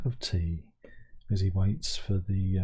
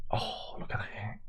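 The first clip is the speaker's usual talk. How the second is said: slow hushed whisper of wonder; awed whisper; 2 semitones lower, 12 dB quieter than usual. Real, not acted. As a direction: breathe it out in awe. Awed by snow photo.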